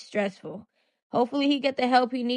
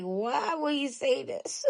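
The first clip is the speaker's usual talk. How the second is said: slow, softer laughing question; laughing disbelief; 5 semitones higher, 6 dB quieter, slower than usual. Retold or imitated. Vlogger retelling her reaction, laughing in disbelief. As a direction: laugh through the words in disbelief. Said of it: "I was like".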